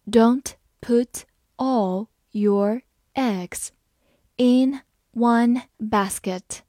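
slow, clear word by word reading. Shown as a demonstration. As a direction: read it slowly for learners. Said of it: "好，我们慢慢来读。". (慢速朗读)

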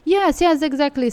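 excited agreement. Real, not acted. Eager agreement with host.

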